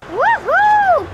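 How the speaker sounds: high, loud joyful woohoo; excited whoop. Acted. Ken revving up his motorcycle, high loud whoop. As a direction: let it rip like the ride just started.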